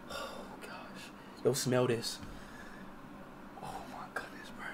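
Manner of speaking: drained, whispered relief; barely any voice left; breathless whisper. Real, not acted. Exhausted streamer whispering to chat after the ordeal, drained.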